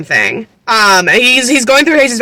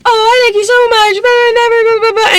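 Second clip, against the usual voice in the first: high-pitched sing-along mock gushing; mock-sweet high voice trailing into blah-blah; mocking imitation; 11 semitones higher than usual. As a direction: babble it high, dismissively mimicking him. Mimicking character's gushing evasions, blah-blah.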